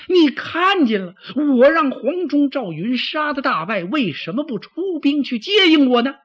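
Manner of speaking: high, loud, heated demand; angry reproach. Acted. Defeated general berates the deputy who sent no help, angry. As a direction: demand an answer at the top of your range. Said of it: "徐晃这气".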